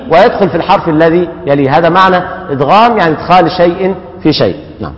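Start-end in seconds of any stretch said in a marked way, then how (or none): none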